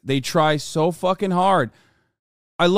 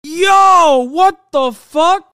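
high, loud, drawn-out disbelief; disbelieving shout; 11 semitones higher, 8 dB louder, much slower than usual. Real, not acted. Streamer hearing the spokesman again, disbelieving shout. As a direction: stretch the words out in shock. Still reacting to the accent.

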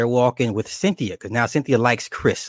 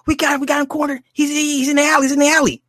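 high, urgent call that builds louder; excited cop on the radio; 3 dB louder, faster than usual. Retold or imitated. Cop radios the detective that he's cornered, high and urgent. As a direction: rush it out like a cop calling backup.

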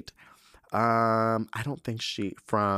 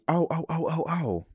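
surprised; 7 semitones higher than usual. Real, not acted. Sudden realization while reading.

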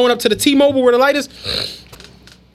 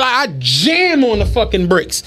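ordinary talk

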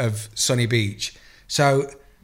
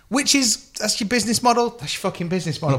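high, mock-amazed disbelief; incredulous; 9 semitones higher than usual. Real, not acted. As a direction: sneer at the business plan in high disbelief. Mocking the topless-photo deal.

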